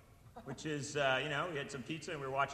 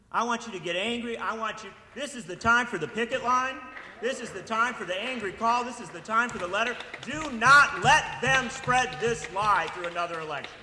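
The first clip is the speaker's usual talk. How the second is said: loud, higher, building call to arms; fiery orator's climax, louder with each line; rising rally shout; 4 semitones higher, 8 dB louder than usual. Real, not acted. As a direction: shout the crowd into action, slowing on the last line.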